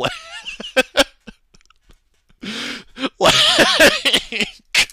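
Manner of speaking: very high, loud laughter into a stretched like; high laughing squeal. Real, not acted. Laughing at his haters' DMs.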